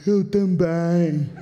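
low, slow, lifeless answer; deadpan mimicry. Second guy in the shop answering, low and slow. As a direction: drag the words out, bored to death. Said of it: "E o outro".

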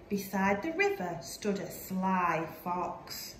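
plain reading voice

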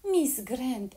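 high, loud glad cry of the name; overjoyed recognition; excited exclamation. Acted. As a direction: call out the name in delighted surprise. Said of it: "exclamă Lady Helena".

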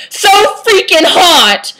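hammed-up lustful exasperation; gushing outburst. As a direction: wail it like an overwrought teen. Gushing outburst in melodramatic reading.

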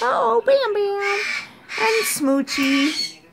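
high, slow laughing coo; delighted. Real, not acted. Owner delighted by Bam Bam, laughing high coo. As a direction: melt over the bird.

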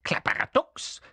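rough, whispery creature growl. Acted. Little creature cursing the delay, growling. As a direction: growl it as a grumpy oath. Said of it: "knurrte das Männchen".

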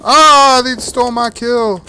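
loud, high indignant yelp; outraged cry. Real, not acted. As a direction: cry out at the stolen kill. Enemy steals his kill.

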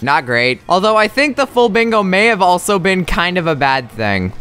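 ordinary delivery

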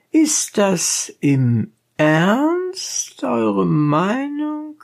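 very high and very slow, cool question; cold-blooded. A narrator's reading. Donkey driver coolly questions the dentist, very slow and high. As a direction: stretch it out coolly, rising at the end. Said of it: "mit der ganzen Kaltblütigkeit".

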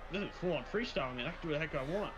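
ordinary talk

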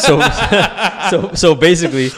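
high, giggling through the words; can barely talk for laughing. Real, not acted. Cracks up at the accusation.

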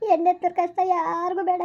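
ordinary talk, nothing marked

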